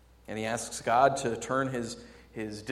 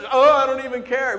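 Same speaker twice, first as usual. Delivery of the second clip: loud, high put-on "don't care"; mock defiance; 11 semitones higher, 13 dB louder than usual. Preacher acting out a rebelling teen, mock defiance. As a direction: throw it out in exaggerated protest. Imitates teen feigning indifference.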